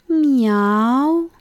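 drawn-out hungry meow; hungry cat meow. A narrator's reading. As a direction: plead like a hungry stray cat. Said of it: "Modulația de foame a pisicilor".

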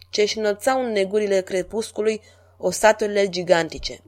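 ordinary delivery

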